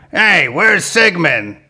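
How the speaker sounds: slow, drawn-out cartoon monster dad; Big Daddy. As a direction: call out slowly in a put-on character voice. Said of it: "there's Big Daddy, of course".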